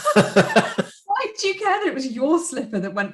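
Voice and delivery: high, laughing incredulous question; amused disbelief. Real, not acted. Podcaster laughing at the girl's odd priority, amused disbelief. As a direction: burst out laughing as you ask.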